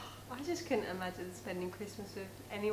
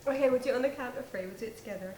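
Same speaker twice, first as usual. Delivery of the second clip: usual speaking voice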